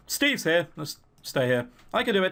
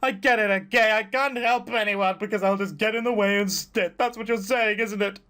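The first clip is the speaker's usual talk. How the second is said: near tears; 4 semitones higher than usual. Acted. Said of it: "on the brink of tears".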